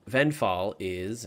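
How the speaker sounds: usual speaking voice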